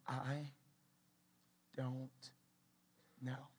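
low, soft, long pauses between words; sober admission. Acted. Preacher facing not knowing, sober and halting. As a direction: let each word land quietly on its own.

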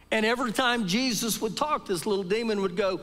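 high, lively preacher's voice acting out the story; storytelling preacher.

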